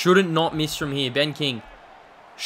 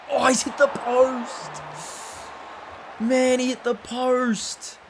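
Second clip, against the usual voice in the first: higher, slow disbelieving groan; dismayed; 6 semitones higher, 4 dB quieter, slower than usual. Real, not acted. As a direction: let the miss sink in, drawn out. Missed goal, dismayed.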